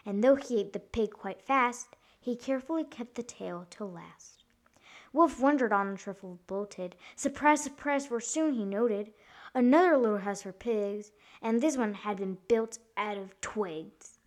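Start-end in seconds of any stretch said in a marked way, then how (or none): none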